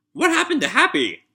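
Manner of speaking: a high, playfully panicked repeat; distressed. Pretend. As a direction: cry it out high in mock alarm.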